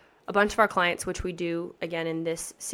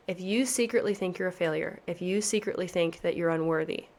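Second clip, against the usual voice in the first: ordinary talk, nothing marked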